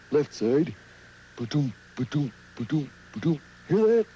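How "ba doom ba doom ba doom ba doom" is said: soft, low ba-doom heartbeat; gentle mock heartbeat; heartbeat sound. Shown as a demonstration. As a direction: imitate a heartbeat, lower and softer. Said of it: "like this".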